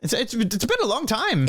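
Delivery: put-on high, halting old gnome voice; Burgle Timbers. Old gnome reunites with young friend, high elderly voice. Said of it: "And he goes".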